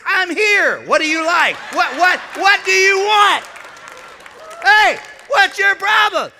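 high, loud, slow confrontational shout; a cop dad squaring up to the driver. Retold or imitated. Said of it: "pulls out his badge and says".

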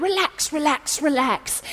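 ordinary talk, nothing marked

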